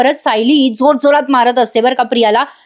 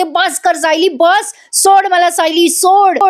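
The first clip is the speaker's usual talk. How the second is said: higher, drawn-out mock wail; imitated wailing plea; 6 semitones higher, much slower than usual. Priya begs to be let go, imitated high wail. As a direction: plead in a raised pitch, lightly dramatized. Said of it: "Priya आता विवळते".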